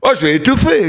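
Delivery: ordinary talk, nothing marked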